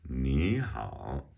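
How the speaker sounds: "你好" sung out, 你 up, 好 down; rising then dipping. Shown as a demonstration. Teacher demonstrating tones, "你好" rising then dipping. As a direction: lift 你, then dip on 好. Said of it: "So it's a rising tone and then a third tone, the dipping tone".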